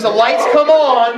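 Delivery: ordinary talk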